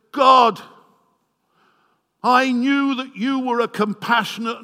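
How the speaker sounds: high, loud, furious complaint; angry shout at God. Acted. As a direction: rail at God for his mercy. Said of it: "He is really angry".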